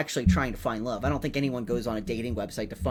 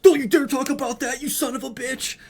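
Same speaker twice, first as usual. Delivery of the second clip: hammed-up furious yell, much higher and louder; mock outraged nerd; 11 semitones higher, 5 dB louder than usual. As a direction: play an offended superfan losing it over a samurai debate. Acting out an offended nerd's outburst.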